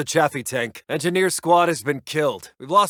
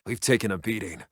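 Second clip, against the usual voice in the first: softer, lower, beaten down; defeated admission; 6 semitones lower, 7 dB quieter than usual. Acted. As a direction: admit the defeat quietly. Conceding the battle.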